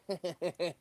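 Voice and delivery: high, chuckling villain laugh; supervillain laugh. Shown as a demonstration. Actor showing how one could play the villain, mock evil laugh. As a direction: put on a supervillain's cackle. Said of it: "you could play him like".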